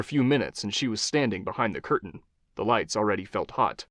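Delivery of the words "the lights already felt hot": plain reading voice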